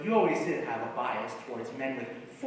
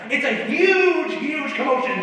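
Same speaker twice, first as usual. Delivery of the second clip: building excitement; 12 semitones higher, 8 dB louder than usual. Real, not acted. Hamming up his gag pitch.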